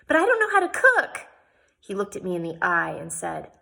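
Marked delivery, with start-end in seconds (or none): 0.1-1.3 much higher, pleading whine; whiny protest. A narrator's reading. Jane whines to Jehu that she cannot cook. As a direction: whine like a spoiled girl.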